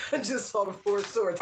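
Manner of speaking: amused, laughing through the words. Real, not acted. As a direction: let a laugh lead into the line. Amused at the card.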